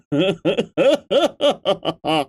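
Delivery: rolling chuckle; amused laugh, voice rising then falling. Real, not acted. Streamer chuckling to the live chat, laughing.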